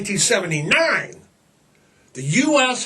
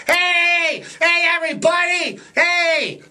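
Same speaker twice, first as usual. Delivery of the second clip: cheery waving call; 9 semitones higher than usual. Retold or imitated. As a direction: call out like someone waving from a parade car. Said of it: "They're all waving".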